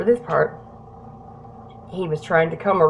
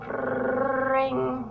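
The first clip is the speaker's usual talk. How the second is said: soft, high held trill; sung-out ringtone on one note; imitated phone ring; 5 semitones higher, 7 dB quieter than usual. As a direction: mimic a phone ringing quietly. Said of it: "My coat would ring again".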